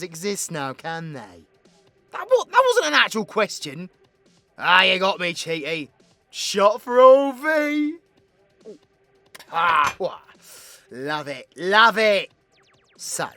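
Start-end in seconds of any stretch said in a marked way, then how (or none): 2.1-8.0 high, very loud amused protest; raucous, over-the-top exclaiming; boisterous outburst. Acted. Mock outburst, caught out by listener. 11.6-12.3 higher, booming gleeful repeat; raucous, delighted cheer; loud delight. Acted.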